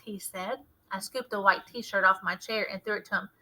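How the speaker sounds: ordinary delivery, nothing marked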